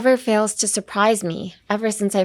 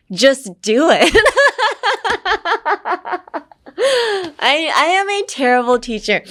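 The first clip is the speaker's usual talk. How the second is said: laughing at yourself, voice pitched up; 9 semitones higher, 3 dB louder than usual. Real, not acted. Laughs at own teaching.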